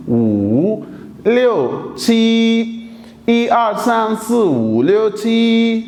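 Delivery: 一 through 七 with deliberate Mandarin tones, 五 dipping far down; Chinese counting with tones. Shown as a demonstration. Teacher revising numbers for students, exaggerated Mandarin tones. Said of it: "तो once again".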